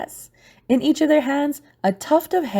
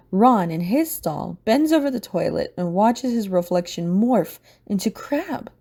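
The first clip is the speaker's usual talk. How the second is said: ordinary delivery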